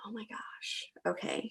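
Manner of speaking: breathless whispered wow; a soft stunned aside; awed whisper. Retold or imitated. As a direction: hush it like a revelation. Friend's insight, awed reaction.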